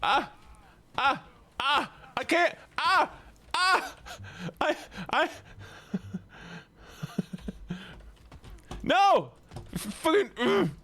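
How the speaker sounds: high-pitched panicked yelps; real frustrated panic; frantic yelling. Real, not acted. Streamer frozen and beaten in game.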